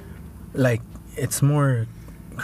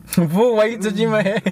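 laughing; 8 semitones higher than usual. Real, not acted. Friend touches his head, laughing.

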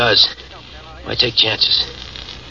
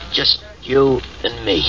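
slow and insistent; slower than usual. Acted. Steve insists on going alone.

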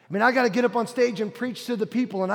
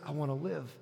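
very soft, almost breathed prayer; quiet under the breath; 6 semitones lower, 11 dB quieter than usual. Retold or imitated. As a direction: murmur it to yourself, barely audible. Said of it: "quietly under my breath".

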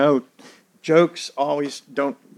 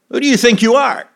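much higher, louder, mock-outraged demand; indignant challenge; 11 semitones higher, 5 dB louder, faster than usual. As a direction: throw it back as an offended elder. Leaders challenging Jesus.

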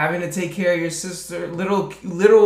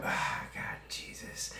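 breathy whisper, much softer; 17 dB quieter than usual. Real, not acted. Cohost, moved by recalling the show, whispers. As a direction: breathe it out, overcome.

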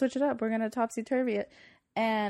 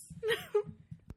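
high squealing laugh; 5 dB quieter than usual. Real, not acted. Laughing at sweet tooth joke.

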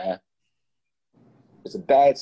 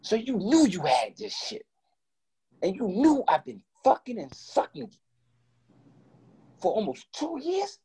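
high, outraged confronting; confronting his girlfriend about hiding HIV; furious disbelief; 12 semitones higher than usual. Retold or imitated. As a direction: rage at the betrayal.

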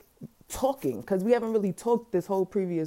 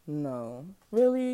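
ordinary talk, nothing marked

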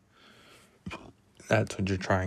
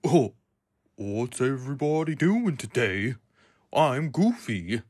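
amateur cartoon dog impression, higher; Goofy; 3 semitones higher, 4 dB louder than usual. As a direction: attempt a higher, louder cartoon goof voice. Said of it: "I can't really do that voice that well".

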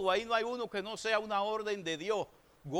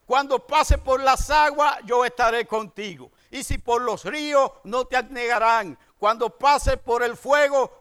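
loud, slow scripture proclaimed to the congregation; full-force preaching; 7 dB louder than usual. Acted. Pastor proclaiming God's promises to his church, loud preaching. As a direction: thunder the verses out like a revival preacher.

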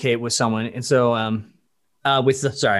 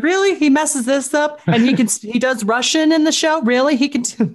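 much higher and louder, a put-on baffled voice; mock incredulous; 14 semitones higher, 4 dB louder than usual. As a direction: play a staffer who can't believe it. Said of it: "It's like".

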